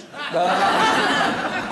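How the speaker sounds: a loud helpless burst of laughter; big laugh. Real, not acted. Star laughing to the host at his own story, a big loud laugh.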